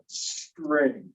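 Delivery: STRING drawn out slowly, T left unpuffed; ordinary T. Shown as a demonstration. Teacher pointing out a regular T, slow STRING without aspiration. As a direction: stretch STRING, keeping breath off the T. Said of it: "I didn't do anything special to the T".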